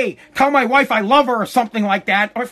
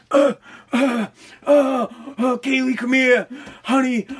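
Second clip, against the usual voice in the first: mock dying man; 4 semitones higher than usual. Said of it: "I'm gonna be dying on my deathbed".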